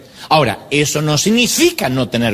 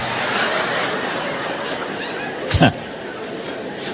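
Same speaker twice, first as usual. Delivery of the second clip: breathy laugh; 8 dB quieter than usual. Real, not acted. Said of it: "Yo no sé cómo el Señor no se las llevó".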